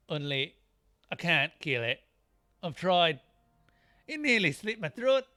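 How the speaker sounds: hearty, loud Scottish warrior; put-on Scottish brogue, animated and loud; Scottish accent. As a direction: play the game warrior with a broad Scottish accent. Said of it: "these guys aren't Scottish".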